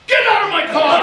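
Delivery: loud, high-pitched outraged yell; angry shout. Retold or imitated. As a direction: imitate a furious man ordering someone out. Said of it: "why so angry".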